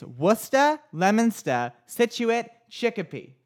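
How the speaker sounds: WUSS-tuh, LEM-in-stuh, SIT-choo-it for Worcester, Leominster, Situate; local pronunciation demo. Shown as a demonstration. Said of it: "you're saying it all wrong".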